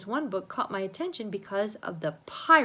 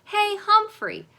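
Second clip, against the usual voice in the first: calling out; 14 semitones higher, 11 dB louder, slower than usual. Acted. Kid calling to hamster.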